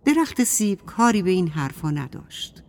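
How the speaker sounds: ordinary delivery, nothing marked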